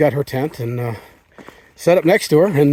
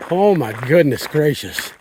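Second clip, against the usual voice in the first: ordinary delivery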